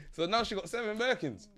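ordinary delivery

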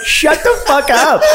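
high, slow mocking shout; retold shout. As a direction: bellow it out in disbelief. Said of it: "And I'm like".

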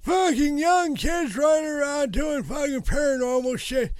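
put-on high, loud cranky old man rant; grumpy old man. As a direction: shout it like a furious old geezer. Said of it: "That's pretty good".

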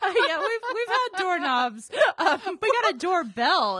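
laughing hard through the words; words bursting out between giggles. Real, not acted. Laughing about the doorknob.

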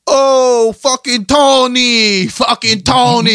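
loud, high, slow Italian-American mob voice; drunk mob accent. Retold or imitated. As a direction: bellow "Tony" like a drunk fan in the stands. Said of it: "they heard my pops talk".

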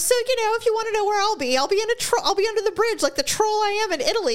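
high-pitched, put-on young woman's voice; imitated TikTok girl. TikTok girl telling viewers about a shop snub, imitated high voice. Acting out TikTok girl's joking reply.

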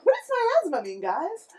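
high, loud mocking player voice. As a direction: mimic a clueless player. GM mocks player's question.

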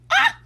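very high, loud shriek; anguished fangirl scream. Real, not acted. Fan reacts to Ed's hurt, screaming. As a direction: burst out in an overwhelmed scream.

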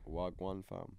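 low, lazy roadman Wagwan fam; london roadman accent. Retold or imitated. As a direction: drop into a London street accent. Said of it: "I say it, but I don't think".

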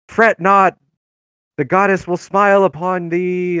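high, loud, booming street-preacher voice; soapbox preacher. Retold or imitated. Cleric preaching to the podunk town, high and loud. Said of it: "him being on a soapbox, being like".

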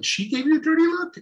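high, incredulous mock question.

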